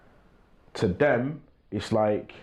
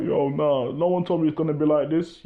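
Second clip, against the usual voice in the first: mock crying on phone; 5 semitones higher than usual. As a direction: lightly mimic a young man whining down the phone to his mum. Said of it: "be crying on the phone talking about".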